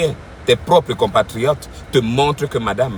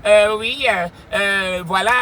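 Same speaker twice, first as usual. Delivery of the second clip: imitation de Blancs; 8 semitones higher, slower than usual. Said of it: "en train de parler comme les blancs". (imitation moqueuse de l'accent des Blancs, voix haut perchée)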